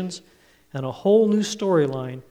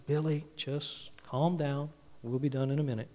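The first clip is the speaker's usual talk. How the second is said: lightly voiced dad keeping his cool, softly; quietly patient dad; 7 dB quieter than usual. Dad to wailing three-year-old in the store, quietly. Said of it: "Dad was quietly saying".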